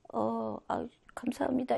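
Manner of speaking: soft, hesitant thanks; timid mumble. Retold or imitated. Teacher acting a shy person thanking, timid soft mumble. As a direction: mumble shyly. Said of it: "이게 아니라".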